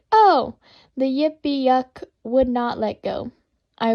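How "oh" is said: a sudden loud, high yelp; hollered cry. Retold or imitated. Bitten on the toe, hollering loud and high. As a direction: holler it out as the creature bites. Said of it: "I kicked and cried and hollered".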